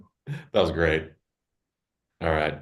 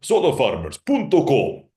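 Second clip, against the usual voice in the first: Spanish radio ad announcer, a touch louder; Spanish ad guy voice; 3 semitones higher, 5 dB louder than usual. Shown as a demonstration. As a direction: pitch it like a Spanish commercial. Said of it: "Spanish language ad guy voice".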